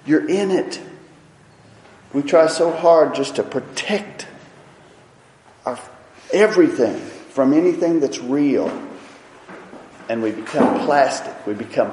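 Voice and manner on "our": ordinary delivery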